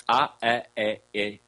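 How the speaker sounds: A, a, e, e as pure vowels ah, eh, eh, ay; pure vowel run. Shown as a demonstration. Teacher attempting the seven vowels, A, a, e, e as pure sounds. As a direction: run through A, a, e, e without English glides. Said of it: "If I do it too fast".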